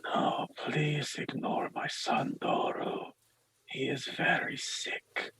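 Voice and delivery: slow, soft whispered priest voice; weary priest. Acted. Father excuses his sick son to visitors, soft whispered plea. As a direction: whisper it slowly, pleading quietly. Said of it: "starts to murmur something to himself".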